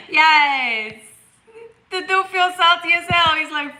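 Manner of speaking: losing it at the chat; laughing hard. Real, not acted. Cracking up at chat.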